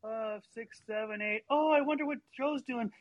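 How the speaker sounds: put-on high, drifting kid voice, counting and losing track; a boy counting while his mind wanders off, pitch jumping up; distracted little brother. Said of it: "So he'd be like".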